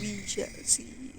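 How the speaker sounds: slow, breathy menacing whisper; ominous whisper. Retold or imitated. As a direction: whisper it like the villain. Echoing the game villain's line.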